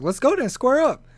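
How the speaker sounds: high-pitched call to fight; mock challenge. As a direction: play at squaring up to a kid.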